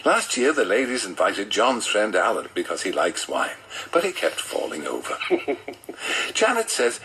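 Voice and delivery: usual speaking voice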